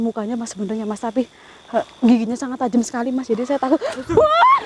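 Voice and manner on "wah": sudden high shriek of fright; startled scream. Acted. Ghost hunter shrieks when the creature jumps out, high startled yelp. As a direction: yelp as if something lunged.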